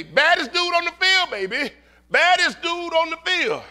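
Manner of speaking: high, loud cheer from the stands; hype shout. Retold or imitated. Father hyping his big boy at the game, high excited shout. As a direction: holler it proudly at your player.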